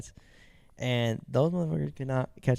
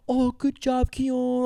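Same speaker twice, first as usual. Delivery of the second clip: high mock praise for the cats; squeaky mimic of her praising; imitating her high sweet voice; mocking Katrina; 11 semitones higher, much slower than usual. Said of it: "then Katrina's like".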